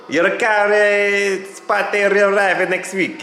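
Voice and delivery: drawn-out, high, loud-ish bad Italian accent; terrible Italian accent. Garage in Italy phoning about the car, terrible Italian accent. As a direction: do a hammed-up, slow Italian impression. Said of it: "That's a terrible Italian accent".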